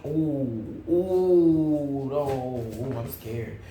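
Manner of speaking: mock scared. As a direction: whimper as if frightened, in jest. Voicing scared somebody.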